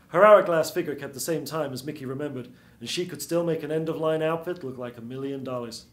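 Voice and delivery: ordinary delivery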